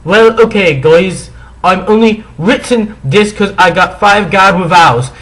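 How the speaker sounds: put-on high, loud whiny girl voice; bratty teen voice. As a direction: read it like a shrill angry teen. Mock-reading bratty author's note.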